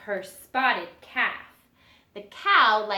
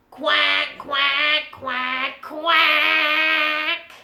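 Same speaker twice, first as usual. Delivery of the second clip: duck quacks; 5 semitones higher, 6 dB louder, much slower than usual. Acted. Mama duck quacking in song.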